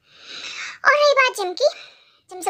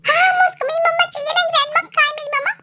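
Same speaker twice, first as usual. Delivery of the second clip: put-on higher, fast excited child; eager little boy; 7 semitones higher, faster than usual. Jim eagerly asking grandma about his mummy, excited. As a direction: burst out like a thrilled kid.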